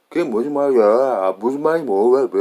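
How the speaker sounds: loud, heavily exaggerated American-accented Korean. Speaker mocking how an American would say it, loud American accent. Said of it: "American would be like".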